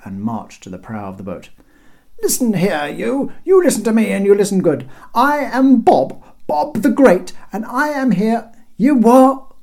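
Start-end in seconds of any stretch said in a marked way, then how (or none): 2.2-8.5 high, loud self-important tirade; indignant shout. A narrator's reading. Bob shouting at the cheeky guard, indignant. 8.8-9.6 high, loud rising query; called from above. A narrator's reading. Guard who cannot hear Bob, calling down. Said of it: "called the figure from above".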